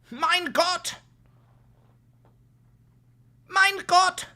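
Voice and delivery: Mein Gott in a hammed-up German accent, high and loud; mock German shout. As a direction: yell it like an outraged German zealot.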